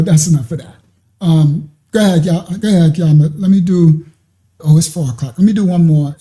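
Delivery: normal talking voice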